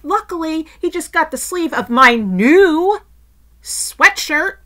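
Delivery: loud, high, pointed complaint; indignant, loudly stressed grievance, for effect; passive-aggressive. Retold or imitated. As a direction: make sure the guilty party overhears. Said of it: "I was being slightly passive-aggressive".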